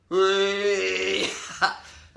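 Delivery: long, loud, high whooping cheer; rowdy cheer. Real, not acted. Local backing Boro all the way, rowdy cheer. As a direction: hold one loud whoop like a football fan.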